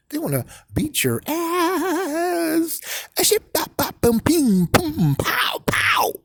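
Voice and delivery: high cartoon punch sounds, bop, boom, pow; mock fight noises. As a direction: play out a cartoon brawl with voice effects.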